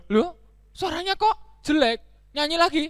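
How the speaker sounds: high-pitched baffled donkey; dismayed surprise. Acted.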